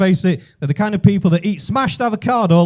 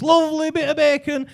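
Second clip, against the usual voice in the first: much higher, stretched-out "lovely bit of bacon"; 7 semitones higher than usual. Retold or imitated. Man in lift to supposed Spaniard, high patronising repeat.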